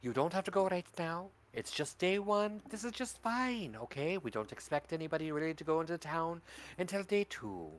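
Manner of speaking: put-on a hushed, kindly old granny; lightly voiced grandmother soothing a child; soft granny voice.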